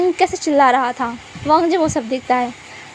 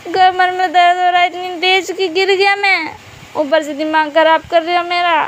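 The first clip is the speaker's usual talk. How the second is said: higher, irritated complaint through back pain; annoyed in pain; 4 semitones higher than usual. Acted. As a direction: grumble angrily that it hurts. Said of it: "गुस्सा नहीं करने को क्या हुआ". (दर्द में झुँझलाकर शिकायत)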